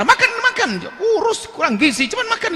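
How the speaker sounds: put-on a mother yelling at her child to eat; nagging mother. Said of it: "Nyuruh makan aja marah". (ibu membentak anak supaya makan, suara tinggi)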